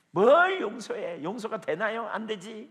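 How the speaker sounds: loud, high scoffing refusal, first word drawn out; acted temper flare-up. Preacher voicing a believer told to forgive, angry outburst. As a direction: blow up at the very idea. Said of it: "막 성질을 버럭버럭 내고요".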